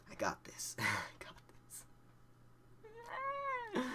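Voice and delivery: hushed, breath-only whisper; barely voiced pep talk; whispered self-reassurance. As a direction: whisper it to steady yourself. High driver psyching himself up.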